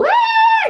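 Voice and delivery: put-on sudden high loud baby cry "Wah!"; a short, piercing mock cry; imitated baby wail. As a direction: burst out like a toddler starting to wail. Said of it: "Kalau tangisan dulu".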